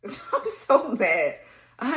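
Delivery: slow, drawn-out venting; pissed off. Real, not acted. Reader fed up with talking about this person, pissed off. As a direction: drag out the words in real exasperation. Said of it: "That shit done pissed me off".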